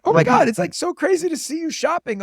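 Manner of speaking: high mock fan gush. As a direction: pitch way up like a starstruck shopper. Fan gushing, retold.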